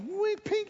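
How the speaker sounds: very high, squeaky fly whizzing past; comic high sound effect; mock whistle and ping. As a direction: sound out the fly zinging into the back of the head. Fly hitting his own head.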